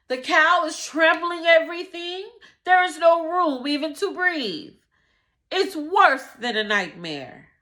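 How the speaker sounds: high, loud frantic pleading; desperate shrieking plea. Acted. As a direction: beg for rescue, voice at its top. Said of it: "he shrieked".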